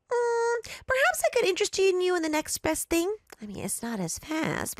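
high chirpy sales pitch; sweet clerk voice. Acted. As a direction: sell it with a bright smile. Clerk offers alternative.

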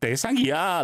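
대상이야 with the final 야 stretched out; dramatic long 야 closing 대상이야; imitated drawn-out ending. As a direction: mimic a learner's lifted, held 야 in 대상이야. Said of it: "you've got the".

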